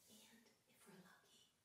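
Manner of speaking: hushed hopeful whisper. Acted. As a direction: whisper it like a secret.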